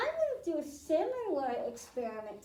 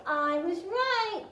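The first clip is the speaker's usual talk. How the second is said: excited; 5 semitones higher, 5 dB louder than usual. Real, not acted. Experiment works, cheers for kids.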